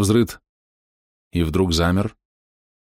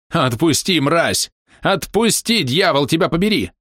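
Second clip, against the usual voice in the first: high, furious screaming; 9 semitones higher, slower than usual. A narrator's reading. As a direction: scream curses, thrashing. Struggling creature screams.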